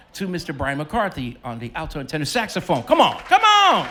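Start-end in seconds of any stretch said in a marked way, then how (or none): 2.8-3.9 much higher, loud urging; hyping the crowd. Real, not acted. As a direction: whip the audience up.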